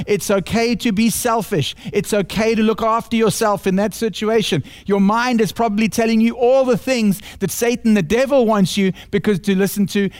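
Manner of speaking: ordinary delivery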